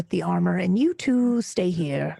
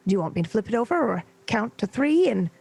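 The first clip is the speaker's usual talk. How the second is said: usual speaking voice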